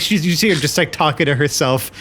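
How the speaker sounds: ordinary talk, nothing marked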